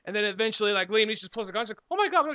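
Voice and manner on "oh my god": mock shocked gasp. Voicing shocked friend in movie scene.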